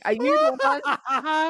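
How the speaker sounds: high, slow mock threat at the door; mock menacing fan. Fans at her door, slow mock menace. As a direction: drawl it like a fan hunting her down. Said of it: "knocks on my door going".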